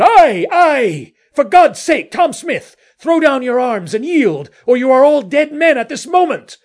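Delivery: high, loud warning shout; full-voiced yell across the dark; urgent shouting. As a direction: shout a desperate order to surrender. Urging mutineers to surrender, calling across.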